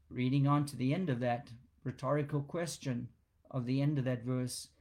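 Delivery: ordinary talk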